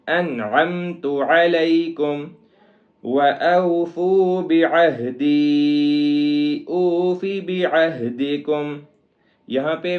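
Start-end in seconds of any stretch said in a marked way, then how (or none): 0.1-8.8 slow, melodic Quran chant; tajweed recitation. Shown as a demonstration. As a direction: recite drawing out the madd. Recites verse. (تجوید کے ساتھ سریلی تلاوت)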